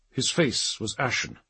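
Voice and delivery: ordinary delivery, nothing marked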